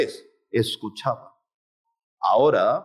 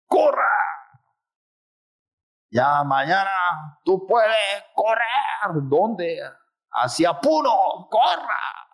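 urging inner voice; 4 semitones higher, slower than usual. Acted. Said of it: "una voz habla".

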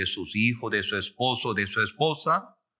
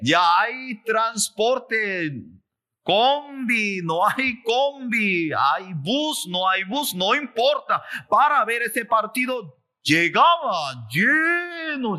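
high, emphatic preaching with stretched words; excited preaching; 10 semitones higher, 3 dB louder, slower than usual. Real, not acted. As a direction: fire off the list high, stretching key words. Preaches excitedly about the crowd.

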